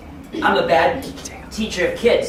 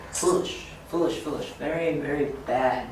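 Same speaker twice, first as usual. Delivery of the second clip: hushed, breathy self-scolding; murmured under the breath; whispered foolish; 2 semitones lower, 8 dB quieter than usual. Real, not acted. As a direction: drop to a whisper and shake your head at it. Mocking his old fear.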